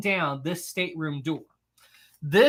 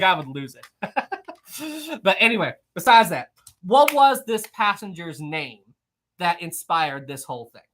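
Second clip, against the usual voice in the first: usual speaking voice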